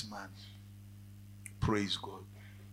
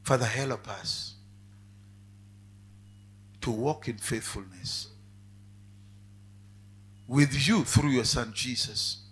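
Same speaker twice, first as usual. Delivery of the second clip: closing prayer; 3 semitones lower, 7 dB louder than usual. Acted. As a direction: pray for the congregation, building. Preacher leads a prayer.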